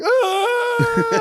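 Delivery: shrill mock scream of a little girl; child's high shriek. Imitating his daughter's scream in the dark. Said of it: "she's like".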